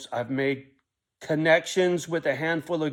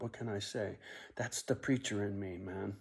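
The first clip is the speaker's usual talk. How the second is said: ordinary talk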